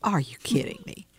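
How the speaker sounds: hushed astonishment; whispered disbelief. Real, not acted. Stunned reaction to the detail.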